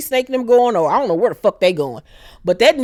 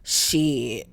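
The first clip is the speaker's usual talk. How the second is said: low gruff; 9 semitones lower, 7 dB quieter than usual. Retold or imitated. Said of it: "So he was like".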